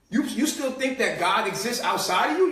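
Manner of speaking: ordinary talk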